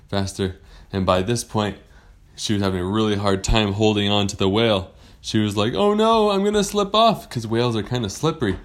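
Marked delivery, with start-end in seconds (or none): 5.7-7.3 high, louder worried cry; acted alarm. Rider slipping on the whale's back, high alarm. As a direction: fret as your grip gives way. Rider losing her grip.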